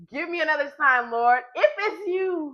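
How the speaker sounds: high, loud, slow laughing plea; laughing as she mock-begs the Lord for a sign. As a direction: beg for a sign while cracking up. Mock pleading with God.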